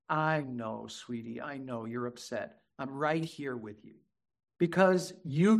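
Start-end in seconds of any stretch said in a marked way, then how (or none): 0.1-4.0 put-on very soft, low soothing to a child; tender empathy. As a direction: comfort gently, voice dropped right down. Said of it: "All I did was connect to her heart".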